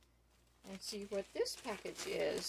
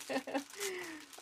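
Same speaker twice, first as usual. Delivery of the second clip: giggle; 9 semitones higher, 4 dB quieter than usual. Real, not acted. Calls friend her shoe fairy.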